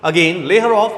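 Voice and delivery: a touch of a captain shouting; mock shouted orders. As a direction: bark orders to the crew over a storm. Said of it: "He is shouting his orders".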